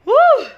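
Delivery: high, loud cry of exasperation; loud exasperated woo. Real, not acted. Venting anger over the girl, a high loud woo. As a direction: let out a loud worked-up whoop. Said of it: "I even get a little angry".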